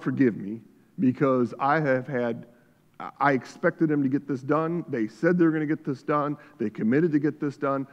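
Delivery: normal talking voice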